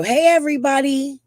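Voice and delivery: put-on high, bright child's greeting; little girl voice. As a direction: mimic a small girl saying hi. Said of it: "the girl Prayer Garden had go".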